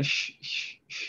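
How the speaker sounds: soft, hushed "sh-, sh-, sh-"; imitating coach's accent. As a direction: mimic a slushy "sh-" sound, unvoiced and repeated. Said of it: "talks with a little".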